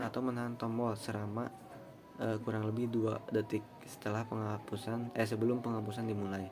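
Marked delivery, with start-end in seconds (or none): none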